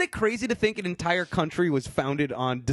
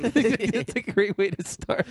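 ordinary talk

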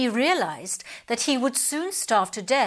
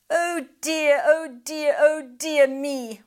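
higher, sighing fake despair; mock-sorrowful pelican; 5 semitones higher, 4 dB louder, slower than usual. A narrator's reading. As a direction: lament it like a sly old bird.

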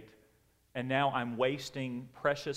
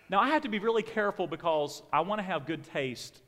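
ordinary talk

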